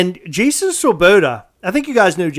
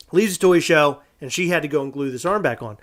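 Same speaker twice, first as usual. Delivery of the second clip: ordinary delivery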